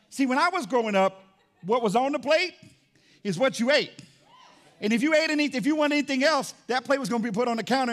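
loud, high, driving preacher's call; full-force preaching. Acted. Pastor reminding the church about childhood plates, preaching loudly. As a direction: pastor preaching hard to his congregation, loud and rising.

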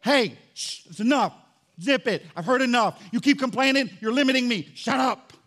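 loud, emphatic telling-off; angry God. Acted. God fed up with a complainer, loud angry rebuke. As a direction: scold in short, forceful bursts. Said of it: "is God really that angry?".

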